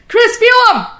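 high, loud giddy call to her husband; excited call. Retold or imitated. Wife calls excitedly to husband in the hot tub, high and loud. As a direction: squeal it out with delight.

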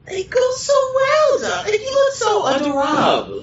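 gushing diva squeal. Acted. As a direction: squeal with delight over the outfit. Judy gushing over tiny outfit.

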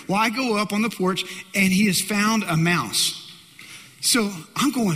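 ordinary delivery

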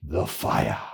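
put-on hushed, solemn English accent; a dramatic English-accent reveal; an English priest solemnly naming the fire. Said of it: "in his beautiful English accent".

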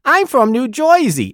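hammed-up Jersey accent, high-pitched; New Jersey accent. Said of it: "if you're from New Jersey".

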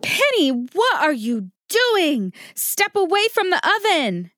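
high-pitched urgent warning yell; alarmed yell. Acted. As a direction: yell in alarm to stop a child in danger. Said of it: "Why did you yell at me".

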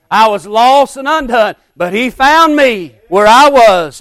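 loud, ringing revival preaching; preaching at full force. Acted. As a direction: preach it out to the whole church.

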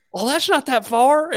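put-on high, drawn-out little boy voice; Dalton, country kid. Kid arguing with teacher over distance, high slow child voice. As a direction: object like an undeterred second grader, laugh underneath. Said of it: "He goes".

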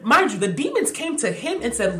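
ordinary talk

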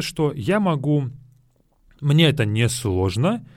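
ordinary talk, nothing marked

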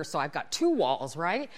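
ordinary delivery

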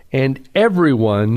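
"everyone" punched with a pitch peak; stress on everyone. Real, not acted. Preacher rereading the verse, emphatic stress on "everyone". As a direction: read it with "everyone" standing out strongly. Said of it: "I'm emphasizing that word".

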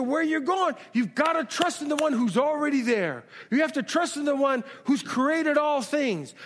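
high, rising preacher's drive, each line hammered home; preaching at full force. Acted. Pastor urging the church to trust God with their future, in full preaching cry. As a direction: build the congregation up, line after line.

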